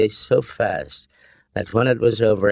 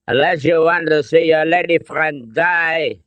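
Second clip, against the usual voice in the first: put-on raised, menacing German-accented threat; thick German accent; 8 semitones higher, 4 dB louder than usual. A narrator's reading. Colonel threatens the lady friend, thick German accent. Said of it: "his thick accent".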